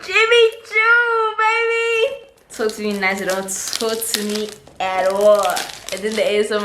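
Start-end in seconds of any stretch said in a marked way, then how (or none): 0.0-2.2 high, drawn-out squeal of delight; excited celebration. Real, not acted. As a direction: sing out the brand name high and long. Celebrating the brand at unboxing.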